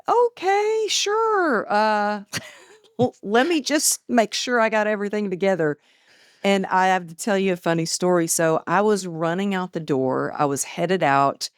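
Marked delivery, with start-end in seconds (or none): 0.1-5.8 bright, sky-high sing-song yes with a nervous laugh; high mock-eager agreement. Author recalling telling her publisher yes, in a much higher mock-cheerful voice. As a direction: play it like a people-pleaser saying sure when you're not ready. Agreeing to early release date.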